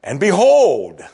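preacher showing the exclamation built into the Greek; exclamatory behold. Shown as a demonstration. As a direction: proclaim And behold like a herald. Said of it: "I'd have to say it like this".

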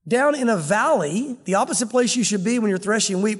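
ordinary talk

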